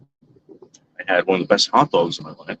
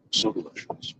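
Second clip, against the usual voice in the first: whispered; 10 dB quieter than usual. Real, not acted. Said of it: "It was so delicious".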